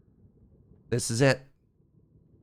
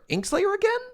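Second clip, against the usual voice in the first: high, amazed double take; can't believe it's them again; surprised. Real, not acted. Same winner shows up twice.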